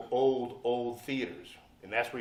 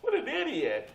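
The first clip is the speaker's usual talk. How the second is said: mocking scoff; 13 semitones higher, 5 dB louder than usual. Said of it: "So I'm like".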